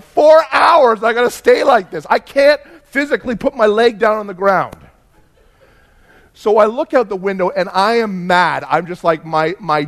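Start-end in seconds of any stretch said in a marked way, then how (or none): 0.0-4.8 loud, higher, exasperated rant; frustrated outburst. Retold or imitated. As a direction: blurt it out, fed up with the cramped seat. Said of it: "and I'm like".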